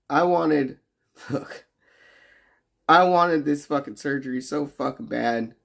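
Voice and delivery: normal talking voice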